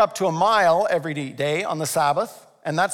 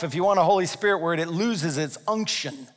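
ordinary delivery

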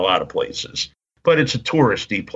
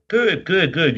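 higher-pitched retold chatter; mock fan small talk; 6 semitones higher, slower than usual. Fan making bathroom small talk with a wrestler, higher mock-eager voice. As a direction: lightly put on an eager fan's reply. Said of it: "And I'm like".

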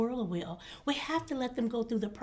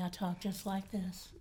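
softer and slow, even and controlled; calm quiet voice; 4 semitones lower, 9 dB quieter than usual. Shown as a demonstration. Mother showing her children how she talks angry, calm and quiet. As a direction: show how low-key you stay when angry. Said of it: "even when I'm angry".

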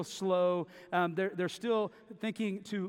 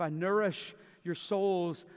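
normal talking voice

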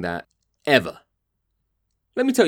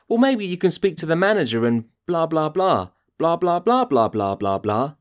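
high, careless blah-blah mimicry; mocking the actor; 5 semitones higher than usual. Mimicking a co-star who forgot his lines, mocking. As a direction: rattle off blah blah like a lazy actor. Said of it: "this is what he did with those lines".

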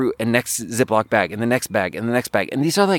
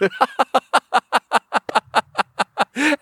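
high laugh; 12 semitones higher than usual. Real, not acted.

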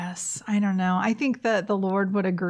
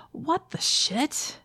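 savoured swear; 5 semitones higher, much slower than usual. Shown as a demonstration. Showing a favourite swear to her co-host, slow and savoured. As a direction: stretch it out for comic effect. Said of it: "I think it sounds hilarious".

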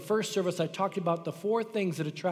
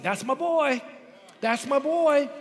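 proud cheer, pitch rising higher and louder on the repeat; proud father; 7 semitones higher, 5 dB louder, slower than usual. Retold or imitated. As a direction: shout it like a father beaming over his boy. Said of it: "it's like God saying".

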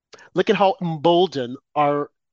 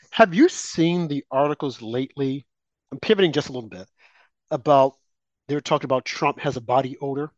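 usual speaking voice

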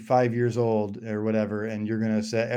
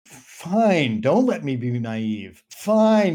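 higher, drawn-out mock exasperation; mock huff; 3 semitones higher, 4 dB louder, much slower than usual. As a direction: play up being put out. Mock huff at being called naive.

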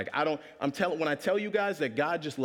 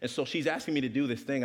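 ordinary talk

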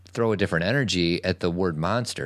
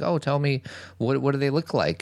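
much higher, lightly acted curious dad; dad's gentle question; 7 semitones higher than usual. Father asking his scared son what he fears, high and gentle. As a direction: play the kindly father, lifting up.

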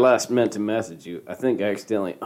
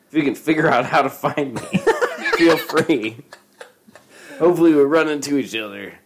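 laughing read; 5 semitones higher, 6 dB louder, faster than usual. Real, not acted. Reading laughing.

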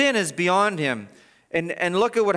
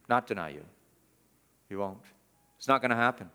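ordinary delivery

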